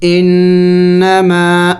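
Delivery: انما slowly, the doubled نون hummed nasally; teacher chanting انما; nasal ghunna. Shown as a demonstration. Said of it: "وہاں غنہ کیا جائے گا".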